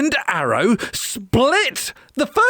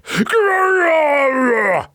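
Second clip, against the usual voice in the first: higher, hammed-up growl; mock snarl; 7 semitones higher than usual. A narrator's reading. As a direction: growl like a sore loser. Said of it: "snarled Sir Roderick".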